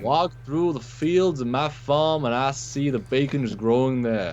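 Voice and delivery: slow, high Southern drawl; exaggerated Southern American accent. Shown as a demonstration. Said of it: "you picked the exaggerated American accent".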